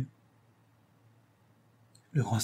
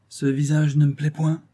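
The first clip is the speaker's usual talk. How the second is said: higher, louder bitter retort; 5 semitones higher, 5 dB louder than usual. A narrator's reading. Jealous lover to the mocking actress, bitter. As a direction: object with bitterness, voice raised. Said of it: "d'un ton amer".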